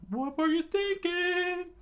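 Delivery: very high, loud squeaky shout; Sanji screaming. Acted. Sanji yelling at Luffy, high loud squeal. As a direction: shriek it at Luffy. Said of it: "Sanji screamed out".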